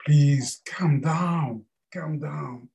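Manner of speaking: very slow, soothing calm down; slow it right down to settle him; soothing Buddha. Acted. Buddha calms the upset Mara, very slow.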